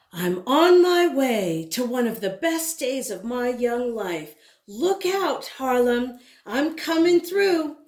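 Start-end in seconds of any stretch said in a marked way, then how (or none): none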